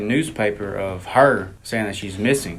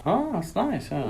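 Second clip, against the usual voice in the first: teasing mock praise; 8 semitones higher, 7 dB quieter than usual. Real, not acted.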